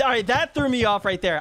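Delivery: ordinary talk, nothing marked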